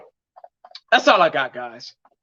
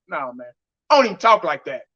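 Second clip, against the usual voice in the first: ordinary talk, nothing marked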